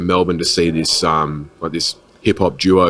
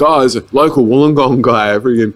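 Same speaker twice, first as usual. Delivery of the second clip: higher, louder mock hype for a local guy; excited hype; 5 semitones higher, 3 dB louder than usual. Sharing a friend's podcast on Facebook, mock hyped gushing. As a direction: gush about him like a fan, hamming it up. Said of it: "I'm just like".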